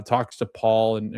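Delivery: normal talking voice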